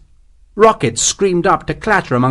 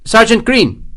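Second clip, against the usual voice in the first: high, loud summons; calling out; 9 semitones higher, 5 dB louder than usual. A narrator's reading. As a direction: call your sergeant over. Lieutenant summons his sergeant.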